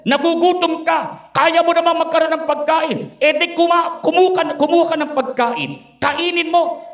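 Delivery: whisper-free sly urging, speeding up at the end; tempting devil. Acted. Preacher voicing the devil tempting the Lord, coaxing.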